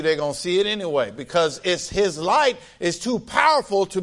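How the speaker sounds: high, fervent preaching, pushing the pitch up; preaching at full force. Acted. Preacher urging the congregation to let their light shine, fervent. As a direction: preach it out, rising with fire.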